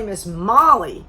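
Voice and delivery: ordinary delivery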